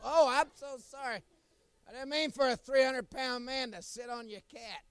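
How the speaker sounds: loud, high, hammed-up fake sorry; mock-sincere yelling to the cat's owner; fake apology. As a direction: shout an innocent apology you don't mean.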